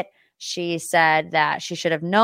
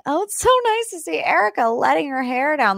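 mocking a laughing housewife; 8 semitones higher than usual.

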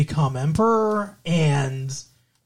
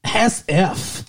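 put-on high Valley girl quip; 4 semitones higher than usual. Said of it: "Valley girl".

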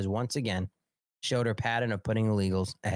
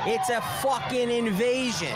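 angry shout; 9 semitones higher than usual. Real, not acted. Host yelling at viewers about the border, angry shout. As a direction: shout it in outrage.

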